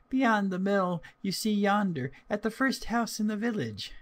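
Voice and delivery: put-on high little-girl voice; an innocent child's high, lilting voice. A narrator's reading. Little girl telling a wolf about her grandmamma, high and childlike.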